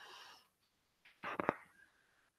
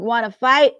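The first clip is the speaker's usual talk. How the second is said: grouchy challenge; 4 semitones higher, 9 dB louder than usual. Acted. Grouchy ladybug picks a fight.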